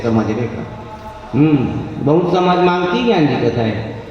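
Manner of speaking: normal talking voice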